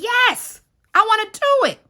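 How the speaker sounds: very high, loud, keen yes; eager acceptance. Retold or imitated. Relative accepting the property offer from Boaz, eager. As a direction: jump at the offer in a squeaky-high voice.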